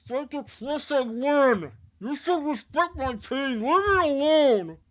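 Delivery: slow, high and loud wounded voice; hurt angry voice. Acted. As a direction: drag out the hurt, then snap the last line. Hurt sister pushes Lynn away.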